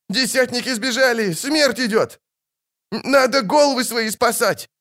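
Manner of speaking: very high, wailing soldier's voice; panicked moaning. A narrator's reading. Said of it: "простонал другой". (панически стонет высоким голосом)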